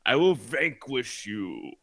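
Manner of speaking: slow, high, solemn heroic vow; dramatic hero. Acted. Player vowing to vanquish the beast, grand heroic declaration. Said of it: "do a dramatic pointing".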